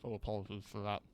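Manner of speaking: talking while chewing food; words round a bite of rice; mouth full. Real, not acted. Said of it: "if you hear me with my mouth full".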